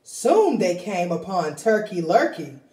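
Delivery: plain reading voice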